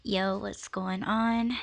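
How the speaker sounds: ordinary talk, nothing marked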